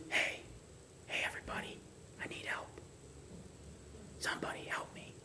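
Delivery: breathless whispered call for help. Shown as a demonstration. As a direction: whisper the cry for help, barely audible. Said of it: "Would you whisper?".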